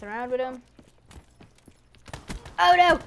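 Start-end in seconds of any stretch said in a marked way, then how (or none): 2.6-3.0 loud, high cry of dismay; alarmed cry. Real, not acted. Gamer reacting to a sudden setback, alarmed cry.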